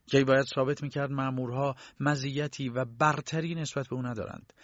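ordinary delivery, nothing marked

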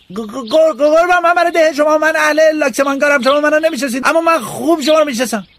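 stammer, then rush to please; boy appealing to the village landlord; stuttering humble boy. Acted. Boy addresses old master.

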